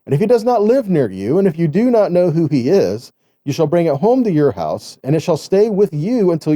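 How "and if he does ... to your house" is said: usual speaking voice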